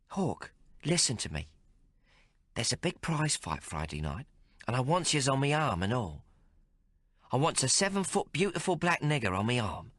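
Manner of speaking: a streetwise barmaid teasing the hero; Maggie's voice. Acted. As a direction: play her worldly and mocking. Maggie grabs his hand.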